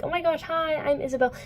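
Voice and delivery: high, put-on perky hello; high girly gush; mock gushing greeting. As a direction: introduce yourself with hammed-up excitement. Retold gushing introduction.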